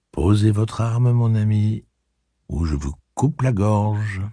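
lent, calme, un peu plus haut; menace posée et lente d'Alain. Acted. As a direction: menace sans élever la voix. Alain menace doucement. (Alain menace Reynolds, lent et posé)

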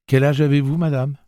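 put-on a curious kid blurting out his question; boy's voice. A narrator's reading. Jake asks the old aunt her age, high and eager.

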